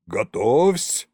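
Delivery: drawn-out, restrained yell; stretched shout of command in a character voice; commander's yell. A narrator's reading. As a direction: call the order out slowly, not at full volume. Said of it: "заорал Колымдай".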